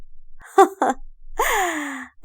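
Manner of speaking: high, drawn-out mocking cackle; sarcastic laugh. A narrator's reading. Fatma gloating at the arrested man, sarcastic laugh. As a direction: laugh scornfully, letting it trail down. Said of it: "وهي بتضحك بسخرية".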